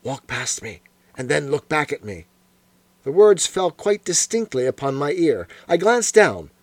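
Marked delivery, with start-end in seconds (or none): 0.0-2.3 hushed and low, barely voiced; old man in the opium den murmurs a secret instruction, low and hushed; low whisper. A narrator's reading. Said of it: "a low voice whispered".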